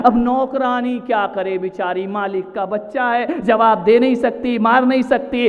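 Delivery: usual speaking voice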